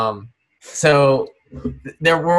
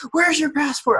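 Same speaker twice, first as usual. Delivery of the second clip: high, alarmed mom voice; mother panicking over a passport she already handed over; panicked mom. Retold or imitated. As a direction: gasp it out as she pats her pockets. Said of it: "she'll, like, feel her pockets".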